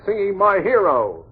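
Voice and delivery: ordinary talk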